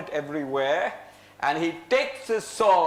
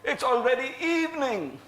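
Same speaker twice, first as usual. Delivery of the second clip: plain reading voice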